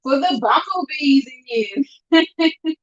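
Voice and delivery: slow teasing call to the bumblebee fans; playful drawn-out call. Acted. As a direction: stretch it out like a playful shout-out.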